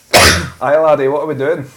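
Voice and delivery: lightly put-on Scottish greeting; Scottish accent. Co-host demonstrating how they talk to themself, mock Scottish accent. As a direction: do a playful Scottish voice. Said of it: "talk to myself and like".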